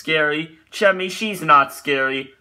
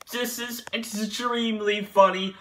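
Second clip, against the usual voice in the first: ordinary delivery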